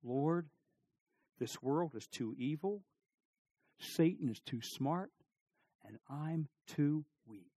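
soft, slow confession of weakness; hushed, measured plea; humble prayer. Acted. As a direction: pray quietly, pausing between phrases. Voices a humble prayer for help.

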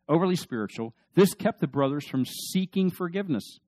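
ordinary talk, nothing marked

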